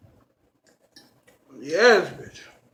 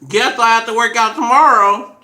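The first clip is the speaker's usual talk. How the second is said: loud; 5 semitones higher, 11 dB louder than usual. Real, not acted. Loud joke after eating.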